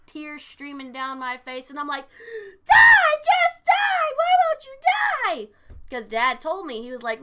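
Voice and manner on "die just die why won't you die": shrieking, furious, the last line dragged out slowly; retold scream at the game. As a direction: scream it like you're losing to a boss. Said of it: "I was screaming and crying".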